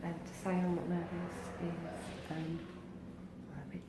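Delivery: hushed, breathy whisper before surgery; nervous whisper. Real, not acted. Whispering to viewers right before surgery, nervous. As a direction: whisper it nervously, barely voiced.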